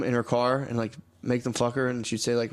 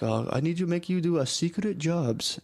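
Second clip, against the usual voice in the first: imitating the Korean boss in a mild put-on accent; mock Korean boss; 5 semitones higher than usual. Mild mock accent as the Korean boss asks for a secret job. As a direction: lightly put on the boss's accent, hushed. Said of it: "my boss would be like".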